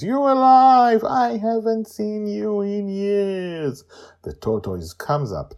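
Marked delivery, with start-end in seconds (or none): 0.0-3.8 high, loud overjoyed reunion; joyful. Acted. Joy reunited with the tortoise, high and joyful. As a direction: cry out with delight.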